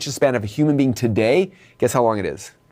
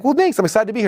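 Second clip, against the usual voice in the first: lightly raised, friendly customer voice; pleased customer; 9 semitones higher, faster than usual. Retold or imitated. Customer thanking the greeter, a raised friendly voice. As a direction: sound glad to be there. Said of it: "the guy's like".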